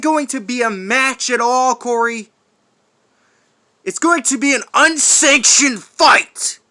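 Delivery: high, loud declaration; triumphant shout. Acted. Warden springing the unsanctioned fight on Corey, triumphant shout. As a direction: announce it like a threat.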